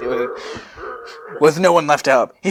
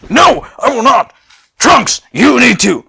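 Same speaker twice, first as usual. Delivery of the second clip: high, loud frantic yelling; shouting; 10 semitones higher, 10 dB louder than usual. Acted. Sissel shouting Trunks down, high and loud. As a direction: yell over everyone. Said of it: "that's you, shouting Sissel".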